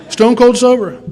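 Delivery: loud, high burst of pride; proud shout. Real, not acted. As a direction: belt it out triumphantly. Proud exclaim of sobriety.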